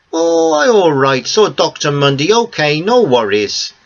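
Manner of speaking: put-on high, slow older Greek man; reassuring broken English, Greek accent. Theo reassuring, out of breath.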